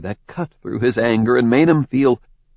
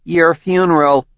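higher, curt, dismissive; cold retort; 7 semitones higher, 3 dB louder than usual. Acted. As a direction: throw it away coldly. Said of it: "She narrowed her eyes".

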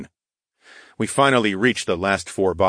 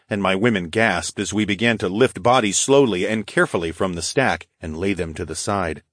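plain reading voice

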